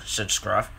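ordinary delivery

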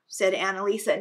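ordinary delivery, nothing marked

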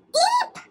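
high, loud chicken eep; startled squeak. Acted. Chicken Little hit by an acorn, startled squeak. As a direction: squeal as if bonked.

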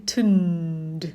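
"tund" drawn out with a humming n; isolated final syllable. Shown as a demonstration. Teacher isolates the back syllable, slow "tund". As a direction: stretch "tund" to a light final d. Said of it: "พยางค์ข้างหลังเนี่ยเป็น".

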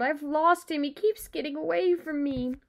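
lightly acted plaintive cry; wailing. A narrator's reading. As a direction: lament it like an upset boy. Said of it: "he wailed".

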